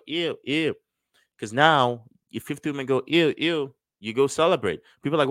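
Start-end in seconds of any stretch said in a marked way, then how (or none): 0.1-0.7 high, prissy ew of disgust; mock disgust. Coach imitating women rejecting him, high mock disgust. 3.1-3.8 high, prissy ew of disgust; mock disgust. Coach imitating women rejecting him, high mock disgust.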